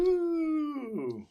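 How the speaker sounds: high, hammed-up woo; a silly little cheer; mock cheer. Cheering the podcast lineup, a hammed-up woo.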